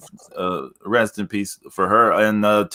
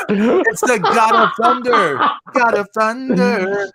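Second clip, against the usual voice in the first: high ring-announcer call; announcer intro; 12 semitones higher, 3 dB louder than usual. Acted. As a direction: hype the entrance like a ring announcer. Introducing Zeus like a wrestler.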